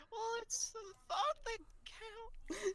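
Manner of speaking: very high, tearful mock voice; sad brave face. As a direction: imitate someone squeaking through disappointment. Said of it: "It, it's so sad".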